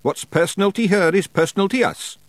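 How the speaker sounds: high Scottish wee man, flatly certain; matter-of-fact Feegle. A narrator's reading. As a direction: state it like obvious fact. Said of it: "said Rob matter-of-factly".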